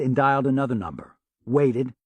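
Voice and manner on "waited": ordinary delivery, nothing marked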